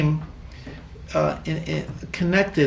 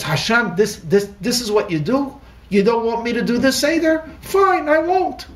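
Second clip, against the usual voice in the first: a worn-out wife snapping at Hashem; bitter outburst at God; 6 semitones higher, 3 dB louder than usual. Retold or imitated. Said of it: "She says".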